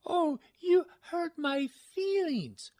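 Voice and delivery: high, loud, slow whiny mock hurt. Host answers the insult with a hammed-up wounded whine.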